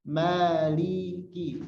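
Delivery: "Maliki" very slow, each letter felt separately; slow, careful letter-by-letter "Maliki". Shown as a demonstration. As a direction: savour every letter of "Maliki". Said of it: "Rasain huruf per hurufnya".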